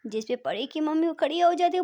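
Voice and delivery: plain reading voice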